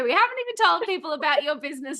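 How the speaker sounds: fast, bright rush of questions; quick eager host. Real, not acted. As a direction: hurry through to the introduction. Host rushing to introduce guest.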